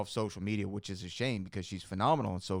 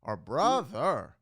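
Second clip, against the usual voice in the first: varied brother; 3 semitones higher, 4 dB louder than usual. Shown as a demonstration. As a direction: try brother several ways. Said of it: "Sometimes it's like".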